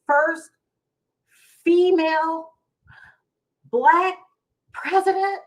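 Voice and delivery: rising, voice catching with emotion; getting emotional. Real, not acted. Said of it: "Now I'm getting emotional".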